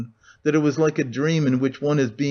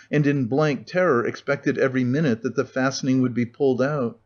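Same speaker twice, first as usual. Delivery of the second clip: usual speaking voice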